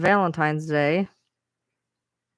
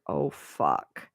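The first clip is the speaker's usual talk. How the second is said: softer, slow dismayed realization; dawning dread; 6 dB quieter than usual. Retold or imitated. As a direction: let it sink in, then a low groan. Sudden realization of ban filter.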